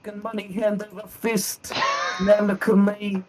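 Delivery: high put-on voice, slowing at the end; comic character impression. Hard-up man recalling better days, high and hammed-up. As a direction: lament lost riches in a high character voice. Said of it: "That's a very good impression".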